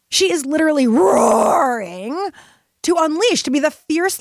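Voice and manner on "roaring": drawn-out, growing roar on the word. Acted. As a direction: stretch the word out like a lioness roaring. Enacting the inner lioness's roar.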